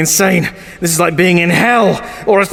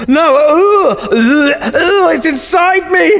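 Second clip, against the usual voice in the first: higher, slow, hammed-up possession; possessed panic; 10 semitones higher, much slower than usual. As the sass possesses him, hammed-up panic. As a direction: fight the voice inside your head. Sass takes over his head.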